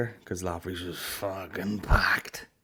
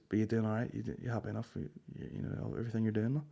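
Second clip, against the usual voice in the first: much softer, near whisper; hushed pity; 10 dB quieter, faster than usual. Retold or imitated. The old mate quietly checks he is coping, hushed. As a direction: drop to a pitying hush.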